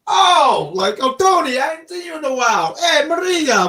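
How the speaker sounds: high excited accented voice, mimicking MJF greeting fans; hammed-up accent, pitched high and quick; put-on accent. Podcast host imitating MJF greeting hometown crowd, high put-on accent. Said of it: "I don't know why I gave him an accent".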